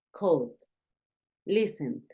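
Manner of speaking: "called" and "listened" with soft D endings; soft final D. Shown as a demonstration. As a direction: end "called" and "listened" gently and quietly. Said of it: "se escucha".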